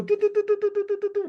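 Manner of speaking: high rapid staccato toots; imitated cackling horn burst; teruah shofar call. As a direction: stutter out a string of short horn notes. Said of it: "it sounds like a chicken cackling".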